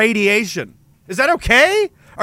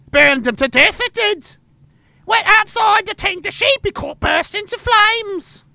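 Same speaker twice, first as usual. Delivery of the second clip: much higher, shouting into the house; panicked highland mother; 12 semitones higher than usual. Pretend. Highland mother shouting her children indoors, high and panicked. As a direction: shriek the children inside like the sky's on fire.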